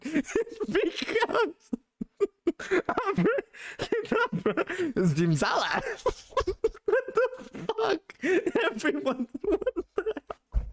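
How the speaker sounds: very high, wheezing laughter over the words; helpless laughing. Real, not acted. Streamer losing it at a German Pokemon name, helpless laughing. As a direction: squeeze words out through a laughing fit.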